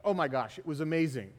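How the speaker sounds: usual speaking voice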